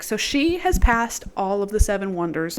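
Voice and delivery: ordinary talk, nothing marked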